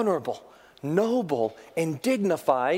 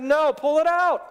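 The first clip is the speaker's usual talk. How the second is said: insistent; 9 semitones higher, 4 dB louder than usual. Retold or imitated. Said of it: "He said".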